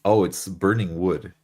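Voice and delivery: normal talking voice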